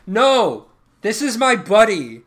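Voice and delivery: outraged and protective; emphatic refusal. Real, not acted. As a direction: protest it loudly, pitch jumping up. Refusing to give away his cat.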